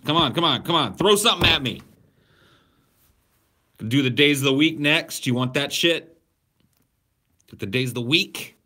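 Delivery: hyped taunting; excited challenge. Real, not acted. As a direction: goad them on, pumped up. Challenging chat to test him.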